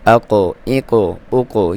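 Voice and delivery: slow "اقو، ایکو، اوکو", each q bouncing; qalqalah drill. Shown as a demonstration. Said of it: "اس کی sound آتی ہے".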